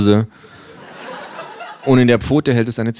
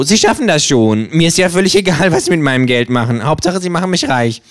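höher, fordernde Känguru-Stimme; freches Känguru; 9 semitones higher than usual. Acted. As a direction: spiel das Känguru dreist und provokant. Känguru spricht weiter. (höhere, dreist fordernde Känguru-Stimme)

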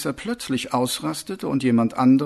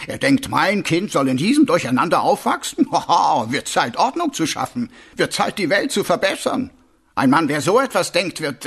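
animated, higher-pitched sergeant with halting ähs; explaining sergeant; 5 semitones higher, 3 dB louder than usual. Acted. Sergeant telling his corporal about expectant fathers, animated. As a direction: lecture earnestly, lifting the key words.

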